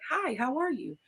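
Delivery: soft, lightly voiced lady's hello; a hint of a passing woman, breezy; mild flirty woman. Woman passing in the restaurant greets Eddie Murphy, lightly voiced. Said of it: "she was like".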